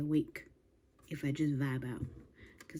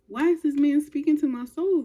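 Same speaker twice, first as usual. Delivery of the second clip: higher and louder, overwhelmed by the lyric; moved outburst; 6 semitones higher, 7 dB louder than usual. Real, not acted. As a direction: exclaim like the song hit your soul.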